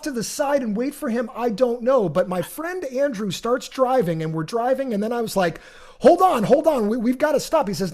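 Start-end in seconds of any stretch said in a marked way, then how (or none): 6.0-7.7 raised, urgent and higher; urgent plea. Retold or imitated. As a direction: beg the driver to pull over. Urging friend to stop car.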